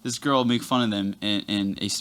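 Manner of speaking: ordinary talk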